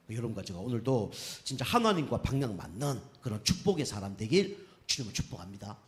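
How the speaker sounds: ordinary delivery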